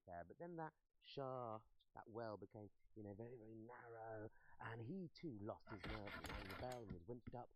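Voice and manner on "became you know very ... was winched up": ordinary delivery, nothing marked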